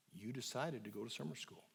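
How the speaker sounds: low and quiet, steady and calm; soft, low and slow. Retold or imitated. Therapist repeating to angry teen, quiet and low. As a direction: keep it low and gentle, like a lullaby of a fact. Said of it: "I just kept saying soft, low, and slow".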